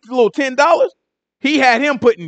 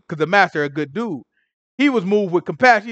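usual speaking voice

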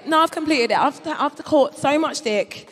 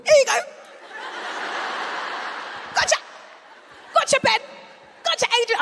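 Pokemon catcher; 10 semitones higher, 4 dB quieter, faster than usual. Pretend.

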